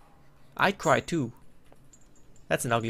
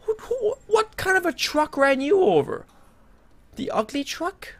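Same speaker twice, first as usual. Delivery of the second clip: horrified squeal; 13 semitones higher than usual. Real, not acted. Gamer facing a hideous monster, high horrified squeal. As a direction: recoil in disgust, voice shooting up.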